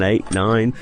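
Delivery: ordinary delivery, nothing marked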